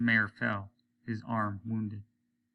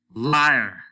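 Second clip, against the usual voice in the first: loud angry yell; enraged accusation; furious shout; 2 semitones higher, 10 dB louder than usual. A narrator's reading. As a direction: scream it at him. Captain screams accusation.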